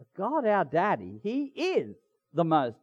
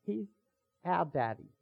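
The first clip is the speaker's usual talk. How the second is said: usual speaking voice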